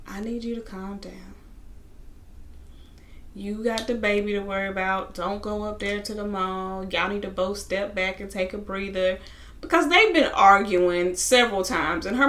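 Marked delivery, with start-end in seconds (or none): 0.1-9.2 quieter, level imitation of a calm mother; calm mom. Mom talking her upset daughter down, calm. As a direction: soothe and settle them down softly. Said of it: "her mom is just as calm".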